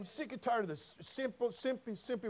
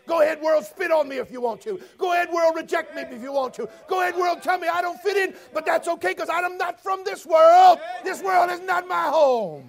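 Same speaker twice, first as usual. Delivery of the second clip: higher, louder preacher's shout; preaching at full force; 9 semitones higher, 14 dB louder than usual. Acted. Preacher to congregation about Jesus spat on, shouting. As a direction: hammer each phrase from the pulpit.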